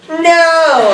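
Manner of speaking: high, drawn-out mock refusal; mock defiant refusal. Rowers refusing to give up the convict, mock defiance. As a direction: hold out a high, hammed-up no.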